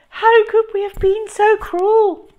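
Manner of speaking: high, loud grieving shriek; hammed-up parental despair. A narrator's reading. Mum imagined mourning her poisoned son, high shriek. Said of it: "Mum would shriek".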